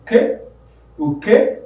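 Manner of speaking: Okay as two syllables, voice lifting sharply on KAY; Okay with a rising, stressed KAY. Shown as a demonstration. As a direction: raise the voice on the second half of Okay. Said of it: "raise up your voice from this place".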